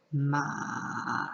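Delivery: "Ma" dips low, then comes back up; dipping third tone. Shown as a demonstration. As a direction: sink deep on "Ma" and lift the tail. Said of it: "dip down, and then you bring the tone back up".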